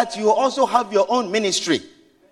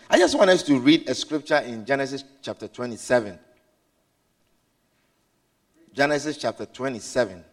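scripture reading; 8 semitones lower, 3 dB quieter than usual. Acted. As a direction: read it out like holy text. Reads scripture aloud slowly.

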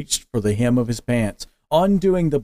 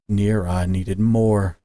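plain reading voice

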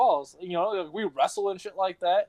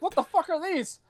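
baffled surprise; 11 semitones higher than usual. Retold or imitated. Finding the gecko's eggs.

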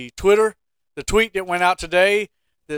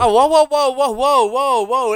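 higher, louder whoas, tapering down; whoa, surprised; 7 semitones higher, 3 dB louder than usual. Real, not acted. Co-host stopping his partner's firing idea, loud startled whoas. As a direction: throw up the brakes in mock alarm.

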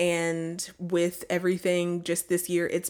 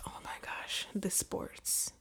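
whispering; 14 dB quieter, slower than usual. Pretend. Whispered joke about sports.

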